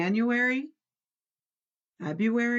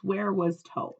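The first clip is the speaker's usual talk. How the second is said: ordinary delivery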